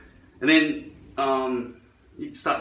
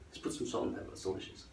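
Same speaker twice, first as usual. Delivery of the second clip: very soft, nearly whispered sales pitch; hushed mock coaxing; 5 semitones lower, 14 dB quieter, faster than usual. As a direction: drop to a whisper, as if offering a tasty morsel. Coaxing audience to taste elephant meat.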